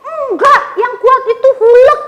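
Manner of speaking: put-on high, loud childish contradiction; imitating insistent kid. Friend argues back for Hulk, stubborn and loud. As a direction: dig in like a stubborn little kid. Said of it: "terus temennya ngotot".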